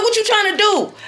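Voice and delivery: high-pitched imitation of challenging him; indignant retold challenge. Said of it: "I was talking just like this".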